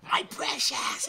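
high, rasping Gollum voice; a creepy creature voice; Smeagol impression. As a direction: hiss it out like Gollum.

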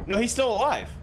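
alarmed. Real, not acted. Monster not dead yet.

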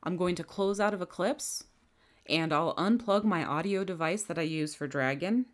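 ordinary talk

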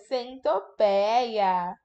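Centopeia said very slowly once the gap is filled; slow recap. Shown as a demonstration. Teacher reading back the completed word, slowly. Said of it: "é o to".

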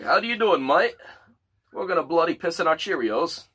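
put-on high, loud Australian-English accent; mock Australian accent. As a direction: greet a mate in a hammed-up Aussie accent. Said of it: "in Australians and, and England sound kinda similar in their accent".

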